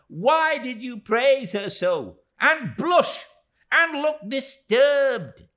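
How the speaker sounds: very high, loud, slow accusing; shrill reproach. Acted. Miss Moucher reproaching David, high and loud. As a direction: pile up the accusations, exasperated. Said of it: "wringing her hands impatiently".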